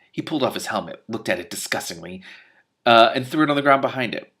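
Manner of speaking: plain reading voice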